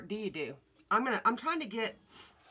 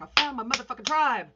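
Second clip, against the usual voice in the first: louder, higher excited exclamation; 6 semitones higher, 8 dB louder than usual. Retold or imitated. Streamer recalling her own video to viewers, excited. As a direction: re-enact an excited, raised-voice outburst. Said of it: "I got all excited".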